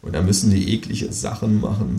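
plain reading voice

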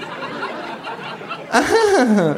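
high kangaroo laugh. Acted. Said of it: "lacht auch das Känguru". (hohes Känguru-Lachen)

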